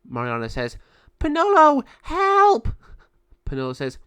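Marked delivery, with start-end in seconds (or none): 1.2-2.7 very high, loud scream for help; desperate cry for help. Acted. Mariana screams to Penelo from the raven's claws. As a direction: shriek to your friend.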